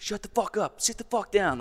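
high-pitched mock yell at normal volume; retold yelling. Rescuer commanding the stranded hiker to sit down, retold yelling. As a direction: imitate barked orders, pitched well up. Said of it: "just, like, yelling at me".

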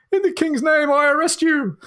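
very high, piercing squeak; shrill, squeaking voice. A narrator's reading. Bear-suited policeman arresting a burglar, shrill squeaky voice. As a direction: squeak it out in a tiny high-pitched voice. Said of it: "in a shrill, squeaking voice said".

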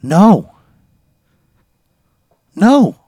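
high, loud, panicked no; freaked-out loud refusal of the haunted painting; horrified refusal. Pretend. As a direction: refuse it in rising alarm.

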